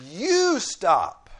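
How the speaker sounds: loud, high order with weight on YOU; demonstrating a forceful imperative; emphatic command. Shown as a demonstration. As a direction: call out a direct order. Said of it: "Or I can say".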